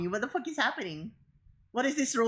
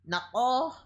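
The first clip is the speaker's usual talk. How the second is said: Filipino mom voice; 5 semitones higher than usual. Acted. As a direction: scold a lazy son like mom. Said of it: "Just like that typical Filipino mom".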